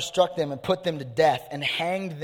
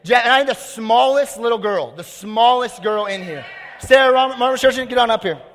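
loud, high emcee call to the crowd; raised, high-pitched shout to the stage; 6 semitones higher, 7 dB louder than usual. Real, not acted. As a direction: summon volunteers over the noise of the room. Calling volunteers up, asking for applause.

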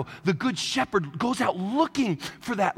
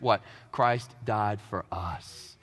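normal talking voice

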